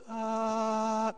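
high, held angelic "ahh"; long sustained note of mock awe; awestruck "ah". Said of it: "they're just, like, awestruck".

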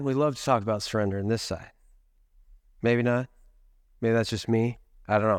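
low-pitched deadpan joke to the congregation; dry irony. Real, not acted. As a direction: drop the pitch and doubt it drily. Ironic claim met with silence.

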